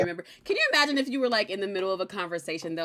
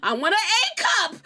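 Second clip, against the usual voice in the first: laughing mock request; 14 semitones higher than usual. Joking request on revival.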